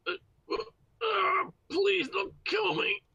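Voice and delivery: high, slow plea through gritted teeth; strained begging through clenched teeth; biting down on pain. Acted. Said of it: "Just through gritted teeth".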